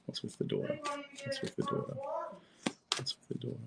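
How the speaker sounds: very soft, barely audible question; murmured aside. Real, not acted. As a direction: murmur it to yourself.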